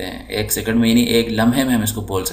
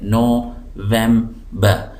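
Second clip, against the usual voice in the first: November very slowly as NO, vem, ber; syllable by syllable; much slower than usual. Shown as a demonstration. Teacher helping learners spell by syllables, November slowly split. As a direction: sound out November in three parts. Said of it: "इसके अंदर syllable तीन है".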